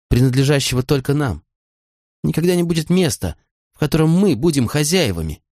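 a touch of lost, grieving woman; grief and bewilderment. A narrator's reading. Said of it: "горем и растерянностью".

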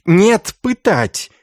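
high, loud outburst; angry insistence. A narrator's reading. Institoris overrules his brother monk demanding torture, high angry outburst.

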